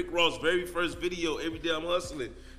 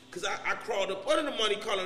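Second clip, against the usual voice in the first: normal talking voice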